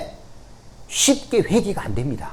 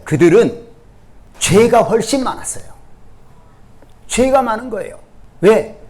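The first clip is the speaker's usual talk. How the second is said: raised, higher-pitched sermon delivery; emphatic preacher, pitched up; forceful preaching; 5 semitones higher, 4 dB louder than usual. Real, not acted. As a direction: drive the accusation home to the congregation. Preacher presses the accusation.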